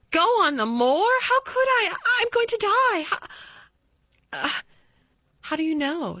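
high, loud startled cry; alarmed. A narrator's reading. Colin startled by Mary's suggestion, high alarmed cry. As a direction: burst out in panic at the idea. Colin's despairing outburst about dying.

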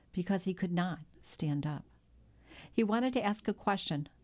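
ordinary delivery

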